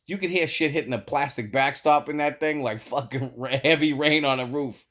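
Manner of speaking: ordinary delivery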